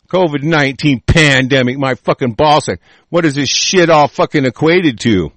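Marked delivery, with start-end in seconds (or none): none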